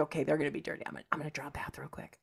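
fast, hushed whisper. Retold or imitated. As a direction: whisper quickly so the lovers won't hear. Voicing Maggie sneaking around.

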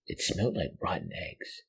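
ordinary delivery, nothing marked

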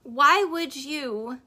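ordinary delivery

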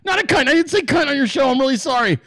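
high-pitched, flustered mock panic; stressed-out blurting. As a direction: act out blurting the wrong thing, then frantically backpedal. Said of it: "that's so stressful, 'cause I'm like".

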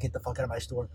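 mumbled low and soft, swallowing the words; muffled quiet mumble. Shown as a demonstration. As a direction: mutter it so it's hard to catch. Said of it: "That sounded like".

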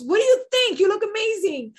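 high, loud enthusiasm; imitated gushing. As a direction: rave about their look, acting it out. Acts director gushing over her makeup.

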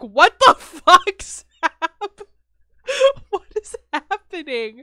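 laughing disbelief. Retold or imitated. Friend crying in front of you, she breaks into loud laughter. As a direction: laugh through the panic as someone cries. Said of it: "I'd be like".

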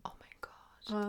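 slow, whispered exclamation; real hushed awe. Real, not acted. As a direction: breathe it out under your breath. Moved by memory of favourite show. (ehrfürchtig geflüstert)

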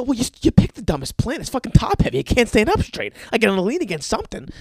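loud irritated defense, speeding up at the end; annoyed retort. Retold or imitated. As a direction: snap back at her, heated. Arguing back with girlfriend.

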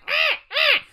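high loud crow caw; crow impression. Shown as a demonstration. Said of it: "That was my crow impression".

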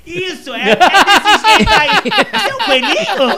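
host teasing her co-host, high and laughing; loud laughter. Real, not acted. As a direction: squeal it while laughing.

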